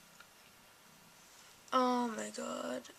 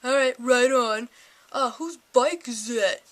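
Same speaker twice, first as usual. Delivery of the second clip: stoner drawl; 9 dB louder, slower than usual. Retold or imitated. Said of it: "And he's like".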